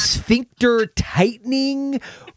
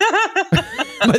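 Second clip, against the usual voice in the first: higher, slow, laughing through it; 12 semitones higher than usual. Real, not acted. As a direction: laugh while saying it.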